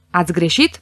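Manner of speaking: usual speaking voice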